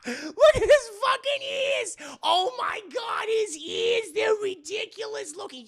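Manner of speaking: cackling mock-shout ridicule; mocking laughter. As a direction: howl with laughter at him. Everyone mocking the ears.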